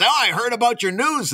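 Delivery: high-pitched acted sympathy; concerned neighbor. Neighbor to man who lost his mother-in-law, concerned. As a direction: commiserate as the joke's neighbor. Neighbor consoles in joke setup.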